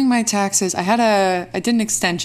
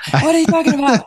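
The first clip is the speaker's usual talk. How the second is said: mock indignant; 8 semitones higher, 5 dB louder, faster than usual. Real, not acted. Teasing coffee reaction.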